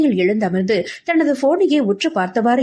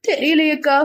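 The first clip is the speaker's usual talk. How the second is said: high, plaintive younger-sister voice; tearful helplessness; 6 semitones higher than usual. Acted. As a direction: answer helplessly, close to tears. Distressed younger sister answers elder.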